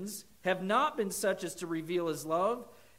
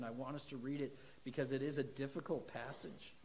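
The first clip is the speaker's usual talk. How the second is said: ordinary talk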